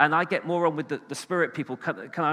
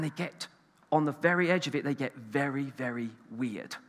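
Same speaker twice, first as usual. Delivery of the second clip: low, much softer, slowing on the last words; hushed aside; 4 semitones lower, 8 dB quieter than usual. Real, not acted. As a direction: drop right down as if confiding. Confiding aside about fringe churches.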